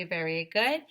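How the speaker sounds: plain reading voice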